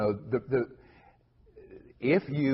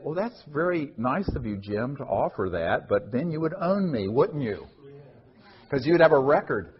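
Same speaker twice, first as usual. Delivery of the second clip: retold reply; 5 semitones higher, 6 dB louder than usual. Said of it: "I said".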